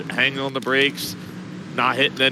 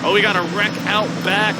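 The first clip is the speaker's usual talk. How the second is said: sudden raised pitch, spotting a crash; excited alarm; 7 semitones higher, 3 dB louder than usual. Real, not acted. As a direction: wreck called out, voice jumps up. Wreck seen during live commentary.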